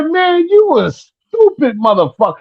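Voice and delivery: high-pitched mimicked dad tirade; imitated high-voiced telling-off; scolding father. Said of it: "He said".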